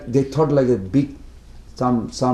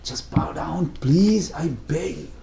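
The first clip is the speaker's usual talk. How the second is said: pleading father; 2 semitones higher, 5 dB quieter, slower than usual. Acted. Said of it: "Father is becoming".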